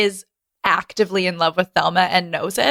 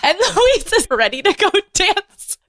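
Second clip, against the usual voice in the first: giggling through the words; laughing high; 11 semitones higher than usual. Real, not acted. Laughing about Louise ready to dance, high giggles. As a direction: squeal it out laughing.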